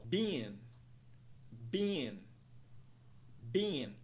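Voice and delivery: "been" as one syllable, like "bean"; isolated model word "been", rhyming with "seen"; long I vowel. Shown as a demonstration. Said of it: "o som de vogal é linha, que soa como I".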